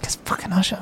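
hushed, insistent repeat; retold whisper. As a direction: act out whispering it to a workmate. Colleague discreetly repeating the tip-off.

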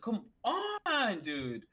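high, drawn-out "come on", then dropping; retold cry of frustration at oneself; exasperated plea. Lifter retells hitting their arms after failing, exasperated.

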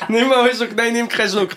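high, breathless laughing talk. Real, not acted. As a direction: laugh through every word. Laughing about warm water.

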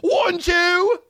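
shrill-pitched outraged yelling at the trailer; high outraged rant. Real, not acted. Reacting to the Cats trailer, a high outraged yell.

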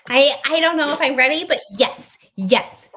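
loud, keyed-up and eager; excited. Real, not acted. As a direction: bring it on, pumped up. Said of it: "Are you ready?".

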